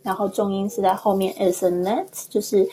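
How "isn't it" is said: "isn't it" rising at the end; questioning rise on "isn't it"; upturned tail. Shown as a demonstration. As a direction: lift the final "it" of "isn't it" as a question. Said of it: "好像尾巴上扬".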